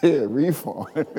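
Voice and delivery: chuckling through the words; slow aside broken up by laughter; amused, laughing. Real, not acted. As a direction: laugh at your own joke. Preacher laughs at his own joke.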